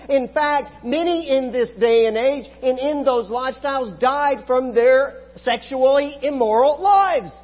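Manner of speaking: loud, high, full-force preaching voice; fiery preaching. Acted. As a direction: preach it hard to the congregation.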